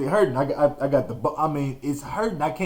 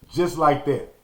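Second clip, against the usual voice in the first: usual speaking voice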